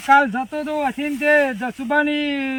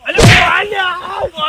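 sudden loud yell; shouting while beating; 2 semitones higher, 6 dB louder than usual. Acted. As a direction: bark it out as you strike. Thief being beaten, shouting. (મારતાં મારતાં જોરથી બૂમ)

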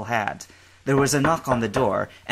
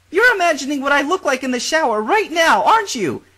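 put-on high, louder cheerful girl; young woman voice; 8 dB louder than usual. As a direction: play a bubbly girl in the shower.

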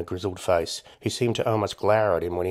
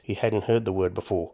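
usual speaking voice

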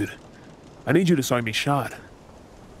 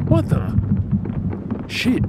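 startled; 11 semitones higher, slower than usual. Acted. Sudden crash aboard ship.

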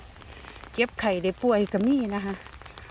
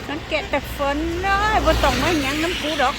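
higher, louder call across the rain; 5 semitones higher, 4 dB louder than usual. Real, not acted. Calls child's name again louder.

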